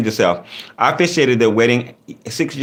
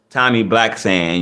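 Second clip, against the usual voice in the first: ordinary talk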